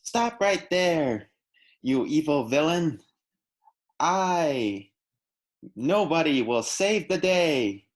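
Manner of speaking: high, loud, slow heroic battle cry; earnest determined anime hero. Pretend. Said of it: "an anime hero voice".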